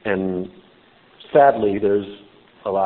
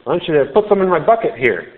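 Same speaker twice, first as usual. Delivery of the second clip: higher and louder, mock enthusiasm; sarcastic; 7 semitones higher, 4 dB louder than usual. Woman mocks Jesus's offer of water, higher, louder and sarcastic. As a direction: gush over the offer with scoffing glee. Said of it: "she's saying".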